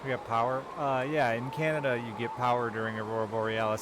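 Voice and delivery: loud yell over roaring wind; shouting over wind. Real, not acted. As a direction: raise your voice over the gale. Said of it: "Sorry I'm yelling".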